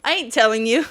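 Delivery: loud burst breaking into laughter; mock-indignant outburst. Mom refusing her kindergartner's spelling question, mock-indignant. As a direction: blurt it out loudly, about to crack up. Mother retells her answer to child.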